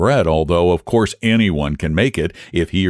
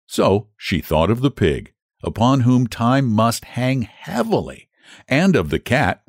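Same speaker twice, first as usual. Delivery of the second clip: usual speaking voice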